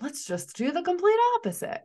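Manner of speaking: high, loud sarcastic sing-song; exaggerated mock-cheerful parody. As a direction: lampoon a naive cheerful fix. Mocking culture's quick fix.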